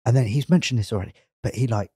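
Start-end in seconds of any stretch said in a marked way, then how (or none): 1.4-1.9 slow hushed whisper. Acted. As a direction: whisper it like a secret. Secret part of story.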